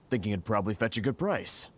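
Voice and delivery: ordinary delivery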